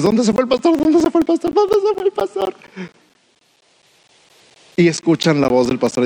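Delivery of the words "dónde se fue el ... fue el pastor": bleating, climbing higher each time; lost sheep crying out, pitch rising with panic; preacher voicing nearsighted sheep calling for their shepherd, ever higher; anxious sheep.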